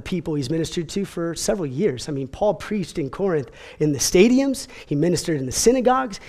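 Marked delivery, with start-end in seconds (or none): none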